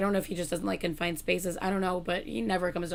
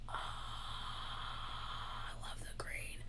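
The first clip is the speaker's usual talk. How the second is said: breathy whisper; 15 dB quieter, much slower than usual. Real, not acted. Admiring merch.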